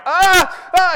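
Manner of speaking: hammed-up high loud wail; mock a child's pained cry; retold sister's loud mock wail; imitated crying wail. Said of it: "and she's crying".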